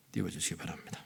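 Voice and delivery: ordinary talk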